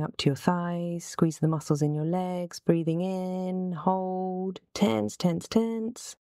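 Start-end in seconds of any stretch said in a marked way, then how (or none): 2.6-6.2 drawn-out start, climbing higher; building tension. Real, not acted. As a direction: coach a held squeeze, pitch rising step by step. Coaching thigh squeeze on inhale.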